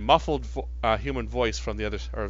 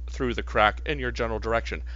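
ordinary talk, nothing marked

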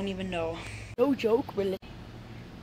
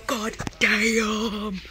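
excited exclamation; 7 dB louder than usual. Real, not acted. Dream toys found in store.

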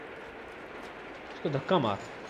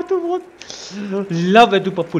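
ordinary talk

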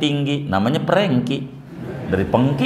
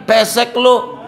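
mock insult; 9 semitones higher, 3 dB louder than usual. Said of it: "Ada yang bilang nih".

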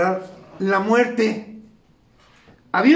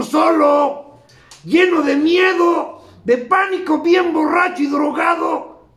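retold cry; 5 semitones higher, 4 dB louder, slower than usual. Retelling the radio speaker's despair.

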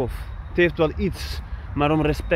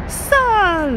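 very high pitch. Acted. Exclaiming at the sights.

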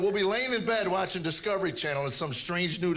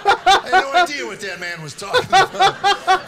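normal talking voice

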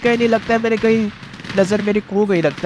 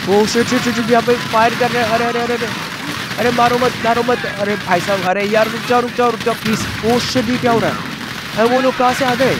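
higher-pitched, urgent gamer panic; panicked pleading; 4 semitones higher than usual. Real, not acted. Gamer begging soldiers to stop firing, panicked. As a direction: blurt repeated pleas in alarm.

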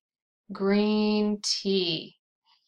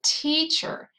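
"Teacher" with a higher, stronger first syllable; stress on first syllable; 8 semitones higher than usual. Shown as a demonstration. Teacher confirming the answer to learners, first-syllable stress on "Teacher". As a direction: pitch up the "Tea" in "Teacher". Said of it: "T is the stressed syllable".

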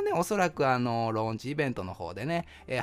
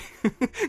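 short high laugh; light laugh; 11 semitones higher, 3 dB louder than usual. Real, not acted. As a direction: laugh at your own joke. Laughs at own joke.

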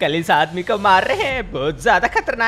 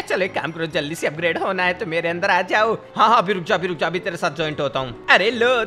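ordinary talk

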